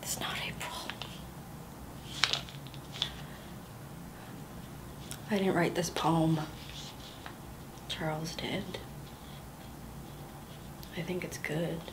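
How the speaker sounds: hushed, unvoiced confession; whispered confession. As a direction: breathe it out as a secret nobody else may hear.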